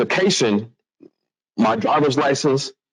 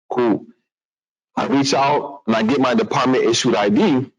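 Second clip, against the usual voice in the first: ordinary talk, nothing marked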